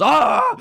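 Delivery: a sharp high cry of frustration bursting out; frustrated yell. Real, not acted. The streamer snaps at the slow queue with a frustrated yell. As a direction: let out a loud, exasperated yelp. Boiling over at the order queue.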